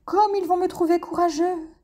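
high, excited boast; proudly repeating; proud lizard. A narrator's reading. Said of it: "en répétant sans cesse".

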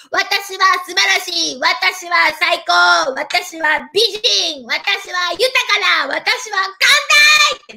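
high, slow, drawn-out affirmations; 大声の唱和. Shown as a demonstration. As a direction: chant each one with rising fervour. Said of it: "これなんで大声で言うといいかっていうと". (高く伸ばした大声で唱える)

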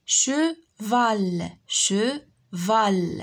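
"Cheval" split into che and val with a gap; syllable by syllable. Shown as a demonstration. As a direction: say "Cheval" in two drawn-out beats. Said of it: "غنشوفوا لي سيلاب".

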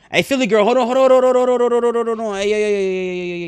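loud rapid-fire run of repeats; urgent calling out. Real, not acted. Calling after passing girl to stop.

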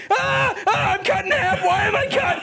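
high pained screams; screaming. Pretend. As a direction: scream in agony. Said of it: "have the rose just be like".

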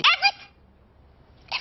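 high, very slow squeal; long high protest; drawn-out squeal. Acted. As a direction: stretch it out in a shriek. Drawn out objection.

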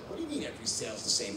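a lightly mimicked baffled colleague; mock confused salesman. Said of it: "It's like".

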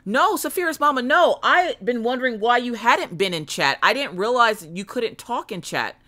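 emphatic denial. Real, not acted. Insisting she wasn't blocked.

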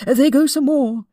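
put-on flustered old king; startled little exclaim; excited king. A narrator's reading.

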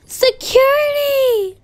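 high, slow, tearful cry of Security; crying shout for help. Acted. Girl screams to school security, crying out. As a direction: call out for help while sobbing.

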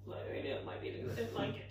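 whispered inner hurt; soft unvoiced inner thoughts. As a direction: breathe the hidden hurt out under your breath. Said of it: "inside you're like".

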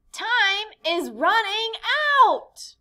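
urgent. Shown as a demonstration. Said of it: "Exclamation point".

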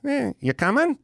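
impatient friendly check-in; imitating Leno. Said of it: "the phone rings".

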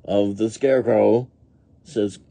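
usual speaking voice

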